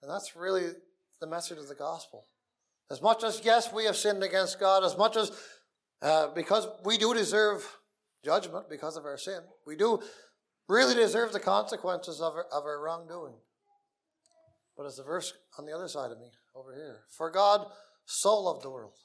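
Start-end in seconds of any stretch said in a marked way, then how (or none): 2.9-13.4 raised, louder preaching voice; fiery preaching. Acted. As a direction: preach it out with full force. Pleading about deserved judgment.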